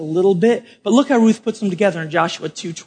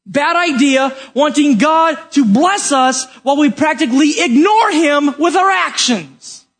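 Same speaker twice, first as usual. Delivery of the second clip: high, insistent pulpit delivery; forceful preaching; 6 semitones higher than usual. Real, not acted. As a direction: drive the rebuke home to the congregation. Preaches with raised voice.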